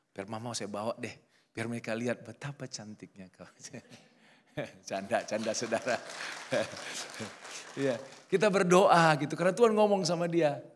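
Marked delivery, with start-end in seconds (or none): 3.5-7.7 laughing it off. Real, not acted. Preacher jokes, laughing at own joke.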